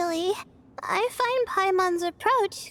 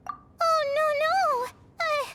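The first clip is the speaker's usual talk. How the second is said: high, panicky no no; flustered denial; 10 semitones higher than usual. Real, not acted. Student flustered when called a teacher, panicky high denial. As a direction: wave off the praise in a fluster.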